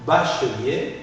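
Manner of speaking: bachelier as "ba-che-lier", three syllables; mute E pronounced. Shown as a demonstration. Teacher models bachelier for student to repeat, E articulated. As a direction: keep the E before -lier in bachelier. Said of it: "je dois la prononcer".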